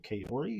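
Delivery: Kaori as "kay-OR-ee", rising; puzzled reading aloud. Shown as a demonstration. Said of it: "I see that, and I'm like".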